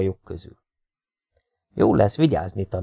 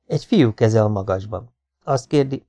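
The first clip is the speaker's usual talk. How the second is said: usual speaking voice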